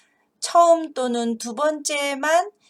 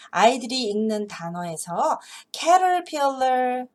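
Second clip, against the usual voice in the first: ordinary delivery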